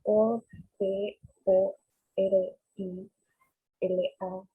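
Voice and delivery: letter by letter, evenly spaced; spelling it out. Shown as a demonstration. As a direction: spell each letter out separately.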